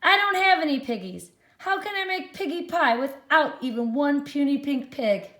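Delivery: high, loud, fast fretful witch. Acted. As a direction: complain loudly like a frantic witch. Witch Gritch complains, reread.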